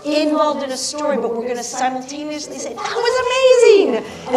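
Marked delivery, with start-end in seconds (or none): 2.8-4.4 high, loud, drawn-out cheer; big delighted shout to the stage; excited exclamation. Acted. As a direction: call it out like a thrilled audience member. Audience cheering the performer, acted.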